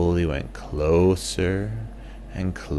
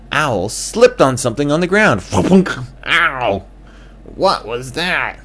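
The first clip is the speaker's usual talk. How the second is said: startled ow; 8 semitones higher, 8 dB louder than usual. Acted. Owl slips and cries out.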